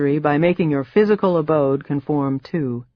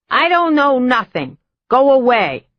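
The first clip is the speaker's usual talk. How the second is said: put-on high, loud old Boston woman, cackling; Boston accent; 8 semitones higher, 4 dB louder than usual. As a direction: brush them off in a Boston accent. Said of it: "picked up an accent now, Boston area".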